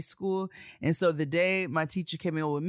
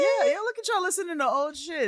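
high, loud teasing gush; pitched-up mock delight at old episodes; mock excited fan; 12 semitones higher, 6 dB louder than usual. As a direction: gush at them, hammed up. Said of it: "I'm like".

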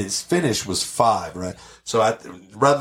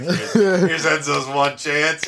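higher, slow, drawn-out teasing voice; mocking the locker room; 6 semitones higher, much slower than usual. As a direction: drag it out like the guys ribbing him.

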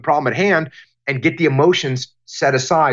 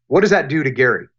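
ordinary talk

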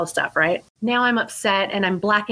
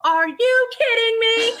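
much higher, slow incredulous squeal; shocked disbelief; slower than usual. Retold or imitated. Guest recalling discovery, squealing in disbelief. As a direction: stretch it out in total disbelief. Realizes she called cops on angel.

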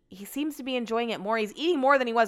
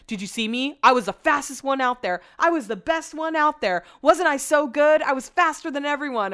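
mocking Brett; 6 semitones higher, 6 dB louder than usual. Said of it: "he'd be like".

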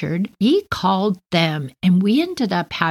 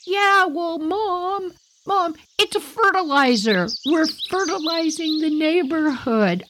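put-on much higher, sheepish kid talking back; cheeky kid excuse; 10 semitones higher than usual. Caught son making excuses to his mom, cheeky.

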